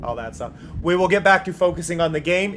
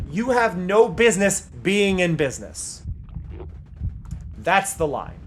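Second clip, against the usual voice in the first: ordinary talk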